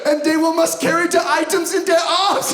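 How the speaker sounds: put-on laughing through a Swedish accent; high-pitched Swedish accent. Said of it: "incredibly accurate Swedish impersonation".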